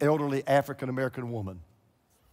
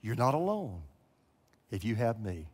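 a low, soft voice calling her name; the voice of God; 5 semitones lower, 5 dB quieter than usual. Retold or imitated. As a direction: speak it gently as the voice she heard. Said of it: "that voice kept coming back".

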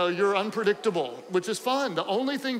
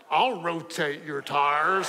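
slow, mock-seductive husband; suggestive man; 5 semitones lower, slower than usual. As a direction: draw it out as a hammed-up innuendo. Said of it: "A man can make anything sexual".

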